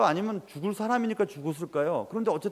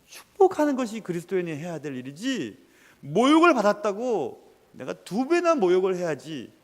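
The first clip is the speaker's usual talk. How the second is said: normal talking voice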